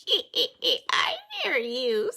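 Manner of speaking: slow, laughing bat taunt; squealing bat. Acted. Bat teases the fleeing pair, slow laughing squeal. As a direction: tease them you can hear. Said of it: "squeals Bad Egg".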